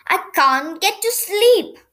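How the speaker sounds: a touch of sleepless irritation; mildly cross. A narrator's reading. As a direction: complain crossly, voice pushed up. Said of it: "said Big Ears crossly".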